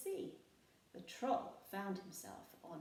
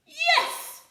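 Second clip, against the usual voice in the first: very high, loud cheer; shout; 19 dB louder than usual. A narrator's reading. Pirates cheering the troll cook, shouting. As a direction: cheer it together. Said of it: "shouted the pirates".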